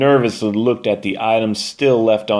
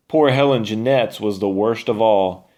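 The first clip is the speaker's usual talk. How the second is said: ordinary delivery, nothing marked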